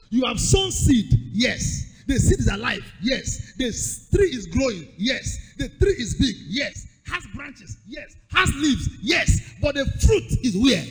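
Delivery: loud rhythmic preaching call; loud sermon cadence with answered yes; preaching at full force. Acted. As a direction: drive each line home to the crowd. Preacher repeats call and response.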